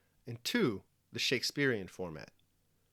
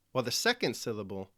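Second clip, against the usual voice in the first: usual speaking voice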